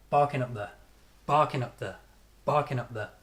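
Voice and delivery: "Barking up there" with strong "Bar", the rest reduced; quick weak syllables after "Bar" in "Barking up there"; strong-weak rhythm. Shown as a demonstration. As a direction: hit "Bar" and let "up there" fall away weakly.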